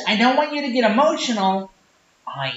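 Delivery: ordinary talk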